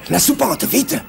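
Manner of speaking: high-pitched, hurried command; urgent order. A narrator's reading. Engineer orders the others into the loft, urgent command. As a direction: order them into cover at once. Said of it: "ordonna l'ingénieur".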